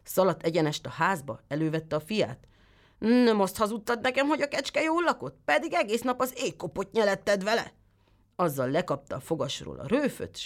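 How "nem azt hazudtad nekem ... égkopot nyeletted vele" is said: scolding father. Acted. Tailor scolds his son.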